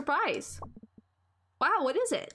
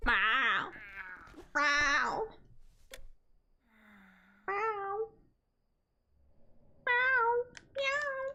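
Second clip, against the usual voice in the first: cat meows; 3 semitones higher, much slower than usual. Pretend. Meowing like a cat.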